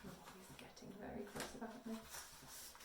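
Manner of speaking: soft, breathy aside to the audience; whispered aside. Real, not acted. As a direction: murmur it confidentially.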